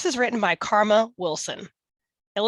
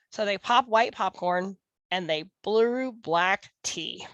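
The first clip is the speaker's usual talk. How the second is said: ordinary delivery, nothing marked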